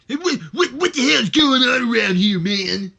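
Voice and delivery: high, loud, gruff off-screen yell; screaming. Acted. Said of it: "just kinda screaming".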